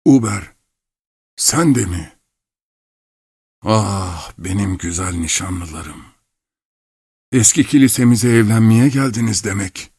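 lightly voiced old man, surprised recognition; dazed old clockmaker. A narrator's reading. Old father recognises the betrothed couple, a touch dazed. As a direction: greet them in mild, wandering wonder.

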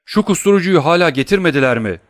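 high, loud impatient demand; exclaiming. A narrator's reading. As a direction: shout the question in urgent frustration. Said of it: "diye haykırdı doktor".

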